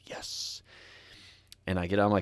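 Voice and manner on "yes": tiny whispered cheer; whispered yes. Retold or imitated. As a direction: whisper a quiet victory. Quiet celebration.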